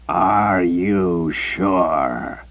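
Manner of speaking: very slow, gravelly menace; deep gravelly voice. Acted. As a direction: drag it out low and rough. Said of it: "the deep, gravelly voice".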